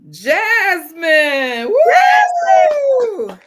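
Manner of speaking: high, loud cheer for the winner; excited winner shout. Real, not acted. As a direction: shout the name, then whoop with joy. Announcing the giveaway winner.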